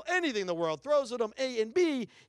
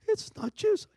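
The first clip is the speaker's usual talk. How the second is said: urgent realization; slower than usual. Retold or imitated.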